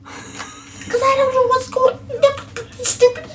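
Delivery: high, slow distraught wail; high crying voice. Acted. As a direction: sob it out in a squeaky voice. Player voicing distraught character.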